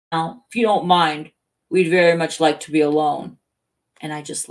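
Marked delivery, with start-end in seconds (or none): 0.1-3.4 louder, emphatic polite request; lightly voiced, pointedly polite; prim dismissive sergeant. Sarge asking to be left alone, pointedly polite.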